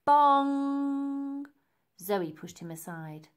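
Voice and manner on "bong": long, loud ringing bong like a huge bell; be Big Ben striking. A narrator's reading. Big Ben chimes above Elsie, a loud held bong.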